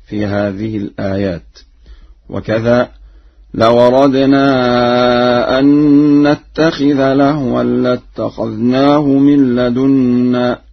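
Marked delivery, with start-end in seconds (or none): 3.5-10.6 slow chant, "لو أردنا" linked as lawaradnaa; Warsh vowel transfer. Shown as a demonstration. Teacher chants another Warsh example, "لو أردنا" as lawaradnaa. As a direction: run "لو أردنا" together without a glottal stop. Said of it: "ورشا ينقل الهمز إلى الساكن قبله".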